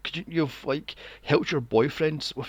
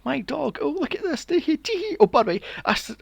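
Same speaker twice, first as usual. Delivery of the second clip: much higher, silly giggly nerd voice; mock him as a giddy dork, pitched way up; mocking nerdy boy; 13 semitones higher, 3 dB louder than usual. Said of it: "'Cause he goes".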